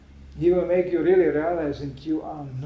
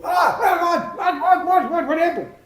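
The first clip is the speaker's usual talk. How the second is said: loud, high panicked-sounding reaction; hammed-up startled shouting; startled driver; 12 semitones higher, 8 dB louder than usual. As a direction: blurt it in alarm, high and loud.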